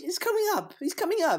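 high-pitched mock crowd repeating itself; lightly acted collective excitement; excited crowd. Everybody spreading news of Ed's coming, mock excited. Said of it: "everybody was just collectively saying".

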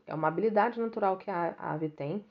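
ordinary delivery

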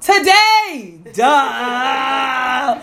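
excited. Real, not acted. Finally remembering the show name.

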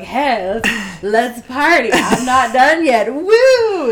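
put-on loud slow rowdy drunk cheer; drunk party girl. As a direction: whoop it up like a drunk partier. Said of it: "was like".

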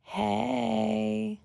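ordinary delivery

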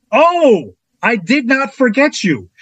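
high, drawn-out acted "oh"; dramatic reassurance. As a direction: imitate a director's big slow reveal. Director turns to him, acted.

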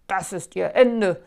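loud triumphant gloat; loud gleeful gloat. Acted. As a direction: gloat in victory. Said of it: "triumphierte er".

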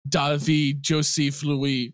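very slow, deliberate French "David Joseph Louis"; French pronunciation. Acted. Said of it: "looks you straight in the eye and".

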